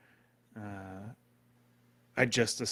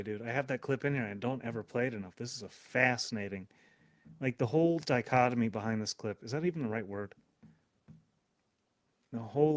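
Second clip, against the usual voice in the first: ordinary talk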